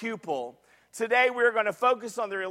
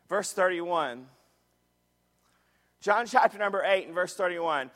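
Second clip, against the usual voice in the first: usual speaking voice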